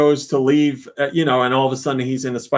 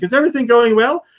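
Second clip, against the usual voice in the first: lightly voiced Aunt May; 9 semitones higher, 4 dB louder, faster than usual. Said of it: "she's like".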